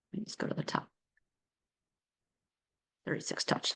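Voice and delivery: very soft, barely voiced mumble; muttering to self. Real, not acted. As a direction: think aloud under your breath while searching. Mutters while searching for folder.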